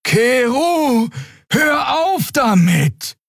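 high, drawn-out urgent cry; alarmed calling out. A narrator's reading. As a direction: call out urgently to make them stop. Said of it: "mischte sich ein alarmiertes Tuten". (alarmierter Zuruf)

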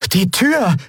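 high-pitched sudden cry; alarmed exclamation. Acted. Boy realising he left the door open, alarmed exclamation. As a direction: blurt it out in alarm. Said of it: "rief Miko aus".

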